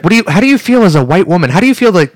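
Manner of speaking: hammed-up interviewer voice; mock TV interviewer. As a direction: play an indignant news host questioning a guest. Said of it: "They're gonna be like".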